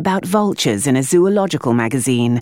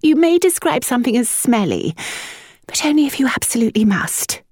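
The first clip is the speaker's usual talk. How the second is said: higher, languid lady; higher fragile mother; weary mother; 10 semitones higher than usual. A narrator's reading. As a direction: correct him faintly. Mother correcting son.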